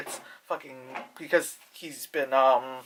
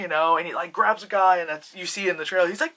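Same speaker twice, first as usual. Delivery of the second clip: ordinary talk, nothing marked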